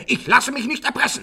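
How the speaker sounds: proud, heated protest; indignant refusal. Acted. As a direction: refuse to bow to the blackmailer. Father refuses blackmail, indignant.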